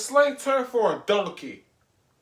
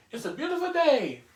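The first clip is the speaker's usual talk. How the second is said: cheery; 8 semitones higher than usual. Retold or imitated. Mock cheerful morning wake-up.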